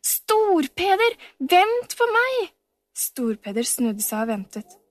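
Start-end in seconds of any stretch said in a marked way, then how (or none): 0.0-2.5 very high, slow, drawn-out plea; pleading call. A narrator's reading. Camilla, running after Storpeder, calls out pleading. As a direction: stretch out the call, begging him to wait.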